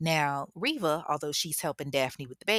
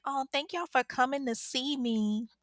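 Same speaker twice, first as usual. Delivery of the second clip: higher, sweet thankful voice; grateful sweet; 8 semitones higher than usual. Retold or imitated. Daphne thanking her visitors, sweet and grateful. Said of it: "She was like".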